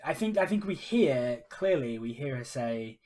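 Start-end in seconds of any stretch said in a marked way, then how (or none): none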